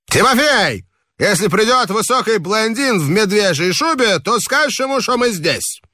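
high, casual order to the waiter; a guest giving the waiter instructions; reveller's voice. A narrator's reading. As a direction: tell the servant with tipsy ease.

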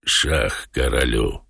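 low, slow, soft character voice; Воланд. A narrator's reading. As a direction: announce it low and unhurried. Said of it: "сказал Воланд". (низким, медленным, негромким голосом)

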